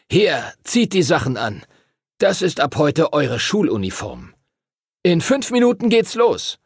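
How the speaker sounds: put-on teacher ordering the boys to dress; brisk teacher. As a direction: hurry them along like a strict schoolmaster. Teacher hands out uniforms.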